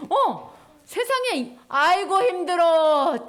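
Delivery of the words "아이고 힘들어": loud, slow drawn-out sigh; sweaty workers complaining; weary groan. Acted. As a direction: moan like you're exhausted.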